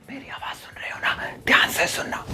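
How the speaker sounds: hushed, voiceless whisper. Shown as a demonstration. Teacher showing what whispering means, in a hushed whisper. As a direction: whisper it so nobody else can hear. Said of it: "बहुत हल्की आवाज में बोलना".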